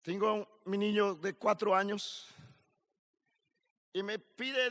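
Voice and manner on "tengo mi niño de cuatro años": ordinary talk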